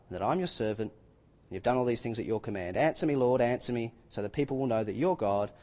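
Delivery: soft, low earnest prayer; hushed sincere prayer; quiet prayer. Acted. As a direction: pray it gently, close to God. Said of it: "prophet stepped forward and prayed".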